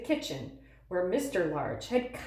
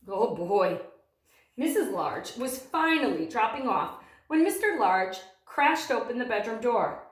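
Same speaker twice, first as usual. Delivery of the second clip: ordinary delivery, nothing marked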